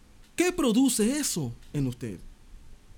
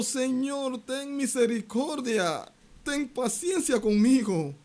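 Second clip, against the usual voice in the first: high, begging plea of the kneeling servant; pleading servant; 7 semitones higher, slower than usual. A narrator's reading. A servant on his knees begs the king for mercy, pleading high. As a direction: beg for mercy, pitched up. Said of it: "le suplicaba diciendo".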